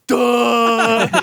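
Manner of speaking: high, slack-jawed dopey cop; dumb cop voice. Retold or imitated. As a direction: play a dim-witted cop. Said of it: "every New York cop in these movies like".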